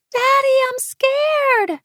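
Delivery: put-on high, slow frightened child crying out loud; a lost child calling for her father; scared little girl. A narrator's reading. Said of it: "sounded like a child weeping".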